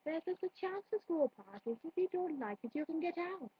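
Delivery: put-on Welsh shopkeeper voice. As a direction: grumble in a sing-song Welsh lilt. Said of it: "it's basically a Welsh accent".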